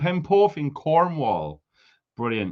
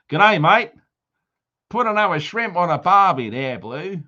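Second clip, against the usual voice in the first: hammed-up Aussie accent, pitched up; mock Australian accent; 4 semitones higher, 4 dB louder than usual. Mock Aussie greeting for Oz viewer.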